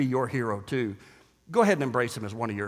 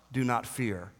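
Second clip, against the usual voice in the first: tender reassurance; 4 semitones lower, 6 dB quieter than usual. Acted. Voice of Christ, quiet.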